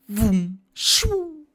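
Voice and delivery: imitated vroom rising into a high whoosh; cartoon sound effect. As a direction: voice something flying past. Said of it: "I love the sound effects".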